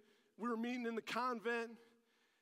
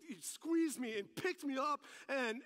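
ordinary talk, nothing marked

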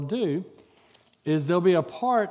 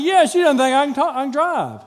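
higher, louder indignant reply; a husband snapping back, higher and louder; exasperated retort; 8 semitones higher, 7 dB louder than usual. Acted. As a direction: push back hard and high. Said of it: "I said".

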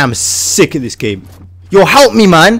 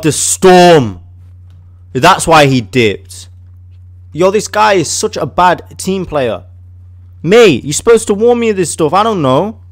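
ordinary talk, nothing marked

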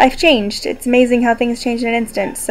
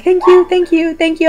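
higher, rapid string of thank yous; retold from the ambulance, a higher, gushing thank-you loop; imitating dazed thank-yous; 7 semitones higher than usual. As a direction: gush thanks over and over like someone in shock. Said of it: "And then I said "Thank you" a lot".

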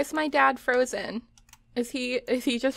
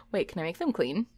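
ordinary talk

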